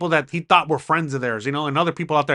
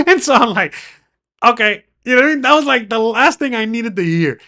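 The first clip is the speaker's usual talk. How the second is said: bitter laugh; 7 semitones higher, 6 dB louder than usual. Real, not acted. Laughs while retelling bitter news.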